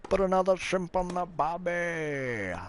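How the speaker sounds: exaggerated Australian cliché, drawn out and high. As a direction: ham up the tourist-ad Australian accent. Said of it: "Never once have I said that".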